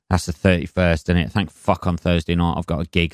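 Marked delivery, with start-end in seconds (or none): none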